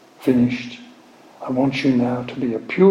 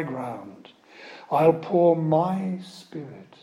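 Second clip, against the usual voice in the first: slow, raised, solemn proclaiming; slow solemn scripture reading; 4 semitones higher, slower than usual. Acted. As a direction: read it slowly like a prophet calling Jacob.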